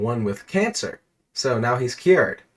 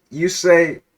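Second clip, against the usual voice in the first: ordinary talk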